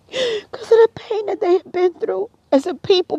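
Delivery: real choked-up, high-pitched voice; tearful. Real, not acted. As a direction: voice rising high with tears.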